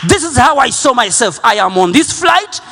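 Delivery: loud, high, declaring his faith to the crowd; a shouting preacher at the climax; full-force preaching. Acted. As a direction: preach it at the top of your voice.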